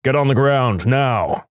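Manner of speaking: lightly voiced booming police command; mildly booming order to get on the ground. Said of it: "a booming voice".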